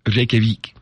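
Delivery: REYKJAVIK said "reh-keh-veek"; native-style pronunciation. Shown as a demonstration. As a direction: end Reykjavik on a long veek. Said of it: "It's Reykjavik".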